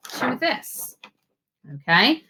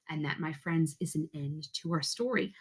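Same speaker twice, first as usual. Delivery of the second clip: hushed story ending; 13 dB quieter than usual. Acted. Teacher closing the story for her pupils, hushed. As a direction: let the story settle with a soft finish.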